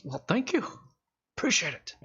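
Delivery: lightly voiced seller thanking; shopkeeper voice.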